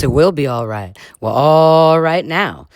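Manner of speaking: usual speaking voice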